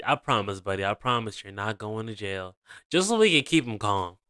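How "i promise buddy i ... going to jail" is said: much lower and much softer, calming; soothing reassurance. Retold or imitated. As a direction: talk him down gently like a scared kid. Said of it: "I would've said".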